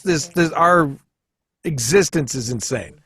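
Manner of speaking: ordinary delivery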